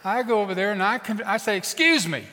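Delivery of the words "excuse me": raised, louder call for attention; firm interjection. Acted. Preacher interrupts a mean mama, firm. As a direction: break in firmly on a stranger. Confronting the mother, first attempt.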